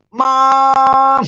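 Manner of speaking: long, high, loud holler; yelled cry for mom. Retold or imitated. Sister jumping out of the bathtub, yelling for Mom. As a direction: stretch it out as a frightened mock yell. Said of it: "and yelled".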